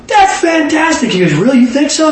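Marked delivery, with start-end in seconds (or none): 0.1-1.1 much higher, slow "fantastic"; gushing praise. Retold or imitated. Telling the dentist his plan is wonderful, high and slow. 1.3-2.1 much higher, hopeful question; pleased surprise. Retold or imitated. The dentist, flattered by the praise, high and hopeful.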